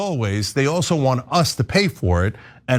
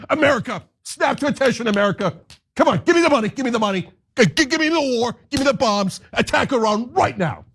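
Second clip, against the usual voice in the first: much higher, greedy shouted demands; mock demanding; 8 semitones higher, faster than usual. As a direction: whine for money and bombs like a spoiled child. Voicing Israel demanding money.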